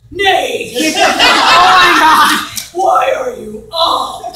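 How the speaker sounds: loud, high, laughing yell; mock yelling. Stratton yelling at Nate at night, laughing. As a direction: yell his name in mock shock. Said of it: "like, yelling at me".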